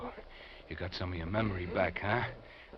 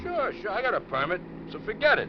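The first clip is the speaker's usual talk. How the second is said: higher, louder, brushing it off; irritated shout; 8 dB louder than usual. Acted. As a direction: shout him down about the permit. Kidnapper brushing off gun question.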